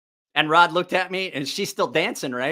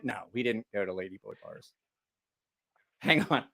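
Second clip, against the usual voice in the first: ordinary talk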